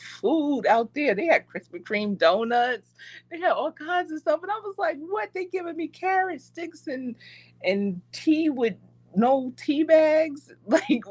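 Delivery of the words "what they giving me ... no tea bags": high, incredulous "what?"; shocked disbelief. Retold or imitated. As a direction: act stunned at the hidden feast. Finding the food hidden from her.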